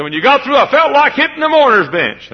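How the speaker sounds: high, loud hearty greeting; old preacher hailing. Retold or imitated. Old preacher greets the visiting preacher, high and loud. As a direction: call out heartily across the room. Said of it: "He said".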